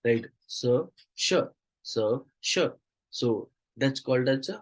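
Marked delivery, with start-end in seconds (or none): 0.5-2.6 "स, श" as isolated sounds, suh then shuh; contrasting s and sh. Shown as a demonstration. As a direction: alternate "स, श" distinctly, hiss then hush. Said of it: "interchanging of sounds".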